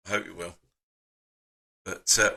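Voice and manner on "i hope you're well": much softer and lower repeat; softly repeating I hope you're well to his friend; quiet echo. Real, not acted. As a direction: say it again almost to yourself.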